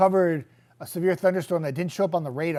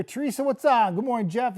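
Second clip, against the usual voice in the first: ordinary talk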